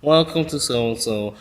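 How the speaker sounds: slow, monotone phone-system greeting; drawn-out robotic welcome; dragged automated voice. Retold or imitated. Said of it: "you have this very dragged voice on the phone".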